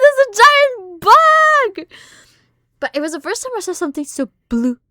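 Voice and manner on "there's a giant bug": high, loud, slow drawn-out yell; panicked scream. Retold or imitated. Telling mom about the giant bug, slow high scream. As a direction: wail it out like the bug is huge. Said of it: "I was like".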